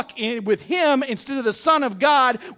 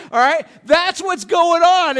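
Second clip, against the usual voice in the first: loud emphatic aside; 5 semitones higher, 6 dB louder than usual. Real, not acted.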